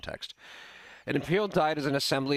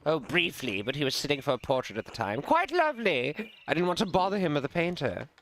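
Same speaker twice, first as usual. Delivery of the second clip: airy, higher-pitched name-dropping nobleman; breezy aristocrat, lingering on his praise; genteel lord; 6 semitones higher than usual. Acted. Voices the lord recalling the emperor.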